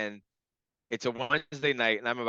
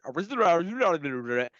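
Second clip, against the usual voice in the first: rapid garbled nonsense, pitched up; imitated gibberish; 6 semitones higher than usual. Client mimicking the partner's reply, gibberish. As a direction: rattle off meaningless syllables. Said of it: "was the gibberish".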